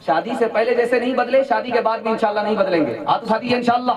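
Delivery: rally the gathering to pledge; rousing preaching. Acted. Rallying congregation to raise hands. (ऊँची आवाज़ में जोशीला आह्वान)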